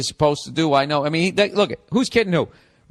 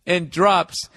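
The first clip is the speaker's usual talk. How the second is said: ordinary delivery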